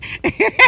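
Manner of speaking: high, airy laugh. Real, not acted. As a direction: laugh up high, mostly breath. Amused at long punishment.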